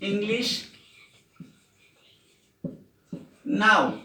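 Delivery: slow, word by word with long pauses; teacher reading an example aloud for students to write down; slow dictation. Shown as a demonstration. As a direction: dictate it slowly for the class to copy. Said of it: "যেমন".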